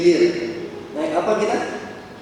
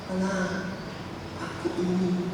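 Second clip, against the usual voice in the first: much softer, almost breathed; hushed reassurance; 12 dB quieter than usual. Acted. Jesus quietly comforts someone facing life's storms, hushed. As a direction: whisper it close to a worried heart.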